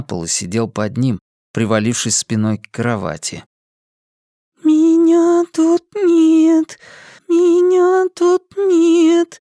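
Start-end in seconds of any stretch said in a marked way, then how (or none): 4.6-9.4 put-on high, slow sing-song little girl; thin drawn-out child voice. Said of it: "тоненько тянула Пелерина".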